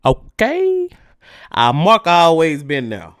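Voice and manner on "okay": a sharp high okay?. Real, not acted. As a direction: fling the okay way up. Emphatic okay in rant.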